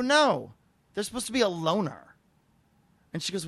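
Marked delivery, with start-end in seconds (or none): 0.0-2.0 loud, higher-pitched protest; indignant objection. Retold or imitated. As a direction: object sharply, retelling the argument. Acted dispute over the rental car.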